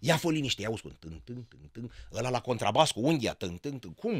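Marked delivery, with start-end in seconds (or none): 1.0-1.9 low, soft "tân, tân" imitating a double bass; soft hummed contrabass imitation; hummed bass plucks. 3.3-3.9 low, soft "tân, tân" imitating a double bass; soft hummed contrabass imitation; hummed bass plucks.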